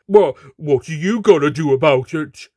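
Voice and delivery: loud, taunting thug; mocking. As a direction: jeer it in her face. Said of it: "he asked mockingly".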